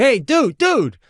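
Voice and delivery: high-pitched retold call after someone; urgent hail, pitch jumping up. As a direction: act out hailing a person down the street. Calling after man walking away.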